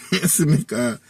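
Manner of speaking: real laugh breaking into the talk. Real, not acted. Man amused telling of his father's hospital story, laughing.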